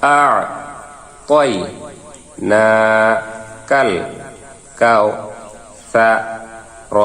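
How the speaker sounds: না, আ, কই said one by one with pauses; broken syllable drill. Shown as a demonstration. Teacher breaks the sura into syllables for students to repeat. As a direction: spell it out syllable by syllable. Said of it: "আবার ভাঙ্গা ভাঙ্গা উচ্চারণ করি".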